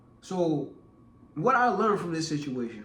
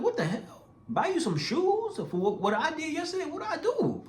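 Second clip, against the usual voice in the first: incredulous outrage; 12 semitones higher than usual. Retold or imitated. Outraged retelling of his reaction.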